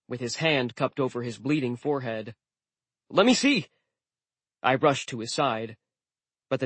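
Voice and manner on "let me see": urgent concern. A narrator's reading. Rushing to wounded friend.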